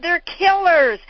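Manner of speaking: high, loud warning from a lectern; fiery warning. Retold or imitated. Father warning the lecture hall, loud and high. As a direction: hammer home the danger. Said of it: "He said".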